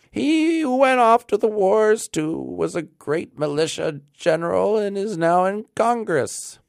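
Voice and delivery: put-on high, thin old man's reply; thin piping old man. A narrator's reading. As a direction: pipe the news up high. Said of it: "an old man replied in a thin, piping voice".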